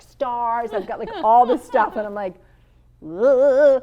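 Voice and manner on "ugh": a loud, groaning Ugh of dread. Retold or imitated. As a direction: groan it out, nervous about the team. Nervous before meeting team.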